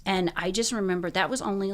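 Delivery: ordinary talk, nothing marked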